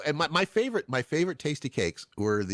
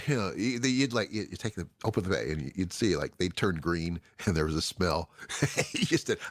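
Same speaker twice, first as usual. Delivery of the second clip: ordinary talk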